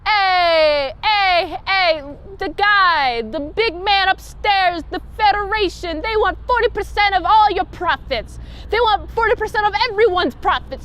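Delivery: 1920s Chicago gangster voice, pushed high and loud; Chicago mob accent, strong-arming them. Retold or imitated. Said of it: "Chicago mob nineteen twenty accents".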